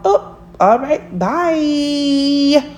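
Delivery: high, airy mock goodbye; shrugging off an ex with a lighthearted high farewell; breezy brush-off. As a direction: wave them off with a careless high bye.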